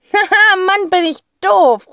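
loud, high, laughing at himself; laughing call. Acted. Boy laughing at himself, loud and high. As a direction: call out, laughing at your own slowness. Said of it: "ruft er".

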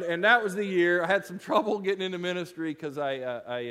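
ordinary delivery

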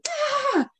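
sudden high mock shriek; high startled yelp. Reliving the swerve for a friend, high startled yelp. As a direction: yelp like you just saw the crash coming.

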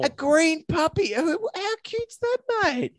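squeaky puppy-talk gushing; cutesy cooing. Pretend. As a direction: coo like fussing over a puppy. Cooing over green puppy.

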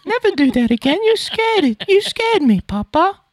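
high, pleading British orphan voice; hammed-up waif begging meekly; Oliver. Son imitating the orphan from the play, pleading British waif. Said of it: "I'm thinking of Oliver".

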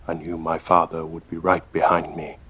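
ordinary delivery, nothing marked

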